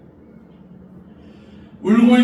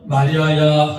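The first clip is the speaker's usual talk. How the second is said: a louder tender call of the name; Jesus calling Mary; 4 semitones lower, 6 dB louder, slower than usual. Acted. Jesus calls Mary by name, a louder tender call. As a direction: call her name gently but clearly. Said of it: "예수께서".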